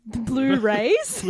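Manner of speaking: "Blu-rays" drawn out slowly with odd emphasis, as a question; slow, questioning "Blu-rays" with the stress moved; shifted emphasis. Pretend. Co-host echoing the Blu-rays title, shifted emphasis. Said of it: "change the emphasis on that word".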